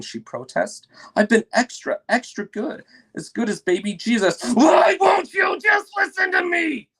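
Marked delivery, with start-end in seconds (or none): 1.1-4.4 a hint of a pleading little girl, higher. Child Lucy pleads. 4.5-6.9 high, loud screaming; furious shout. Acted. Parents fighting, shouting.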